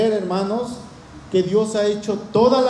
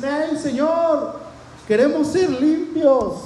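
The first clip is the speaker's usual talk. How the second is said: mock calling out; 7 semitones higher, slower than usual. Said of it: "le gritan y le dicen".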